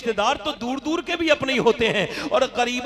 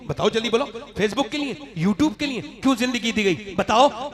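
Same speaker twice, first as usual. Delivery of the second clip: ordinary delivery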